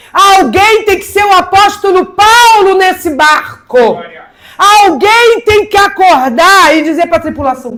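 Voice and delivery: loud, high-pitched fervent preaching; impassioned sermon delivery. Acted. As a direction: preach it out to the congregation, full force. Preaching at full force.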